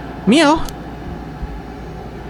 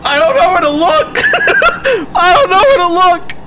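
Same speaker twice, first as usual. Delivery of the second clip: panicked shouting; 11 semitones higher than usual. Real, not acted. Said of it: "I'm freaking out".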